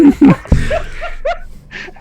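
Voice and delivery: loud high-pitched cackle; loud high laugh. Real, not acted. Laughing at accent story.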